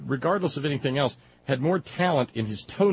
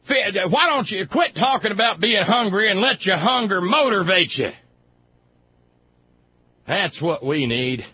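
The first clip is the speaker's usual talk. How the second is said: louder, higher mock-righteous father; loud hammed-up patriotic sermon; ranting dad; 5 semitones higher, 4 dB louder than usual. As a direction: lecture the hungry kids like a zealous dad. Dad voiced ranting at kids.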